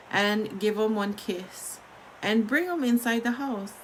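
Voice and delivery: usual speaking voice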